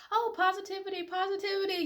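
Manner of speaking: sarcastic high sing-song mock of forced cheer. Mocking relentless positivity.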